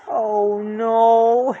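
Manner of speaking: Shaggy dreading the creeper; whimpered. Acted. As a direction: moan it in fear. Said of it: "Shaggy whimpered".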